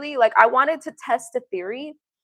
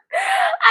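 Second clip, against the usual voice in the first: shrieking laugh. Real, not acted. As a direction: burst out in a shrieking cackle. Bursting after the serenade.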